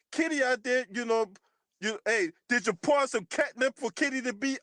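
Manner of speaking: ordinary delivery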